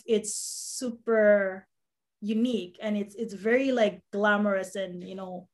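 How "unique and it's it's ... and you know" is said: ordinary talk, nothing marked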